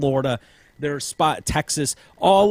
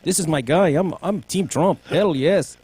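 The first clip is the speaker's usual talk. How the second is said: mock Mexican accent; 4 semitones higher than usual. Shown as a demonstration. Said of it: "Mexican voice like you can".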